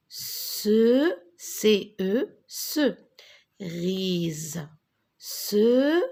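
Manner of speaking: "Cerise" split into "Ce" and "Rise"; slow teacher's blending of "Cerise", syllables held apart; syllable breakdown. Shown as a demonstration. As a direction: sound out C, E as "ce", then add "Rise". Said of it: "répète après moi".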